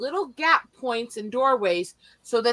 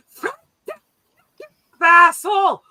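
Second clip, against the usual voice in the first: high, loud mock outraged holler; exasperated yell; 9 semitones higher, 8 dB louder, slower than usual. Grandma cursing at her prankster husband from the kitchen, exasperated yell. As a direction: imitate a fed-up shout from another room.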